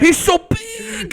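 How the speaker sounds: high, long drawn-out villain wail; mock terror. Henchman awed by huge enemy, mock terror. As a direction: wail it in hammed-up fright. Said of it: "they're like".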